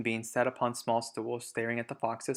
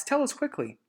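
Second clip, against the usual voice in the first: eager urging. Acted. Missus Fox urges eagerly.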